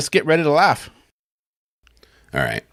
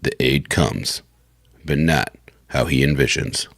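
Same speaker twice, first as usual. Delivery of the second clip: low, evenly paced narration with pauses; slow the opening words to match the rest; slowed-down read; 8 semitones lower, slower than usual. Shown as a demonstration. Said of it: "And slow down".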